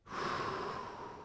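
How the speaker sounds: long slow exhale; soft breathy sigh; demonstrated breath. Shown as a demonstration. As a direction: let out a deep, unhurried breath. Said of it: "Number one is to breathe".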